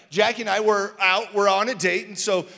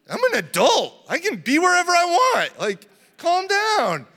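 mock defensive; 10 semitones higher, 8 dB louder than usual. Mock reply to daughter.

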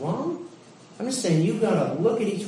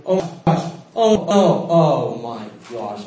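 dismayed outburst; 5 semitones lower, 8 dB louder than usual. Acted. Regretting a missed opportunity, acted out.

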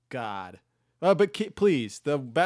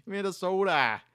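put-on higher, louder Minnesota accent; broad Minnesota accent again; playful Minnesota accent; 7 semitones higher, 5 dB louder than usual. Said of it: "You can't say it without the accent".

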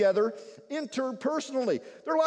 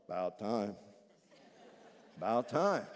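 low, muttered "About time"; grumbling brother; 13 semitones lower, 6 dB quieter than usual. Acted. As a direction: grumble it under your breath. Sulking brother mutters quote.

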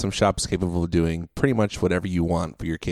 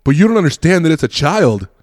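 high, loud pleading protest; mock indignant; 7 semitones higher, 5 dB louder than usual. Dad defending his child to the dude, imitated indignant protest.

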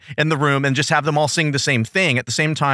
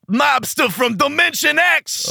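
high slow shouted title; dramatic title announcement; 9 semitones higher, slower than usual. Acted. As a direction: announce it big.

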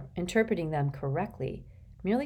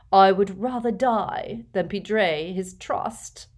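pompous ghost gentleman; 3 semitones higher, 5 dB louder than usual. Acted. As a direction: hold forth self-importantly, stretching the words. Voices Nearly Headless Nick.

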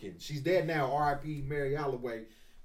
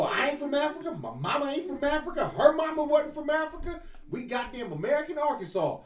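put-on old grandma voice; 10 semitones higher than usual. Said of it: "she go".

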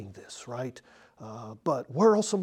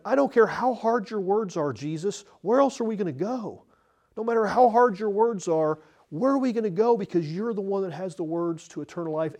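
high, loud impassioned appeal; impassioned Peter; 7 semitones higher, 5 dB louder than usual. Retold or imitated. Preacher voicing Peter's answer to Jesus, impassioned appeal. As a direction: plead it with full conviction, pitched up.

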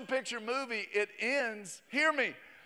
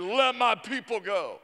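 commanding Moses; 3 semitones higher, 7 dB louder than usual. Retold or imitated. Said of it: "'cause he said".

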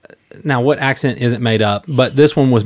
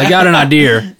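"idea" said "idear", louder; hammed-up New England "idea"; New England accent; 4 semitones higher, 5 dB louder than usual. Shown as a demonstration. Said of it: "they would say idea".

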